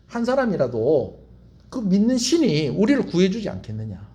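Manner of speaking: ordinary talk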